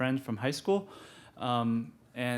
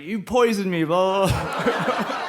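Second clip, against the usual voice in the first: mock yell; 6 semitones higher, 5 dB louder, slower than usual. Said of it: "I yelled".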